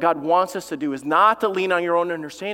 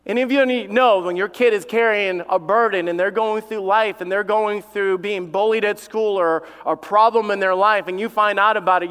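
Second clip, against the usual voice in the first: ordinary talk, nothing marked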